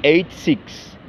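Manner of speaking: slow, careful "Nineteen eighty-six"; slow model pronunciation. Shown as a demonstration. Teacher repeating the date slowly for students. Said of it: "Mil novecentos e oitenta e seis".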